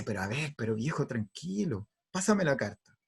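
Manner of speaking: soft, soothing wife; calming wife. Acted. Wife asking angry husband for the letter, calming. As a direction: talk him down quietly.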